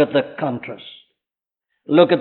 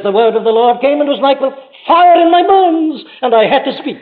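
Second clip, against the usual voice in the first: Jeremiah's fervent quote; 8 semitones higher, 4 dB louder than usual. Retold or imitated. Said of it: "But he says".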